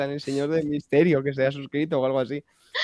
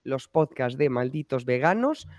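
upbeat host welcome, pitched up; podcast intro; 6 semitones higher than usual. Acted. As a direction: greet listeners like an announcer. Podcast host opening greeting.

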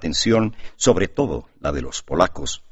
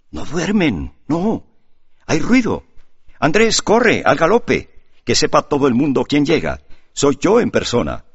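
rising higher and louder into an excited call; growing excitement; 8 semitones higher, 5 dB louder than usual. A narrator's reading. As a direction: build from eager to a full excited shout. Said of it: "exclamó Demetrio, cada vez más excitado". (cada vez más excitado, subiendo hasta gritar)

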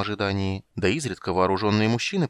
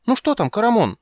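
excited; 14 semitones higher, 7 dB louder than usual. Acted. Tas eager, high voice.

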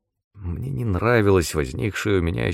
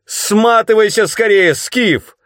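high, loud urgent scream; full-voice shout; 14 semitones higher, 7 dB louder, slower than usual. A narrator's reading. Tananda warns Skeeve to flee, full-voice shout. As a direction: shout a warning at the top of your voice. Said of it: "вдруг в полный голос закричала она".

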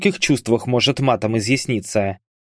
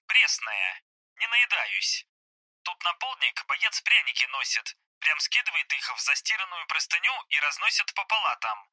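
soft, higher, thin phone-filtered voice; voice on speakerphone; 5 semitones higher, 8 dB quieter than usual. Acted. Hospitalised soldier reporting to his comrades, telephone voice. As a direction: talk as if coming through a phone speaker. Bars heard over speakerphone.

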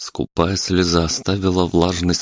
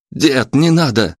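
high, drawn-out plea; raised, pleading shout; urgent cry; 8 semitones higher, 4 dB louder than usual. A narrator's reading. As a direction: cry out to stop him. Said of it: "крикнул ему Лёшка".

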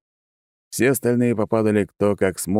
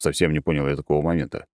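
ordinary delivery, nothing marked

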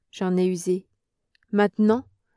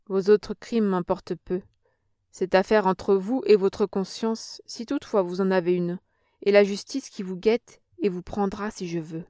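plain reading voice

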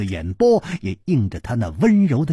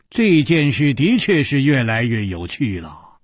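usual speaking voice

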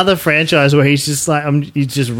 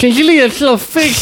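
high, squeaky mock movie star; a silly high celebrity voice; Samuel L. Jackson; 12 semitones higher than usual. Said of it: "make him sound like Samuel L. Jackson".

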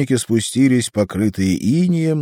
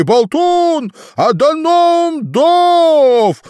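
громко, протяжно «Даноум Дов»; прокричать «Даноум Дов» от счастья; ликующий рёв; 8 dB louder, much slower than usual. A narrator's reading. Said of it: "взревел обезумевший от радости". (восторженный крик)

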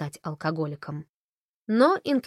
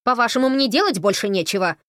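сердито; 5 semitones higher, 6 dB louder than usual. Acted. Бренда продолжает возмущаться.

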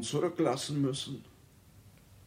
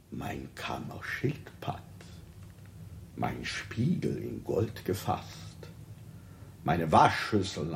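hushed aside; 6 semitones lower, 5 dB quieter than usual. Acted. King lists his belongings.

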